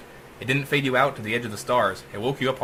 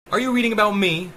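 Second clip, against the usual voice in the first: AI voice; 9 semitones higher, 5 dB louder than usual. A narrator's reading. AI voice from the wall.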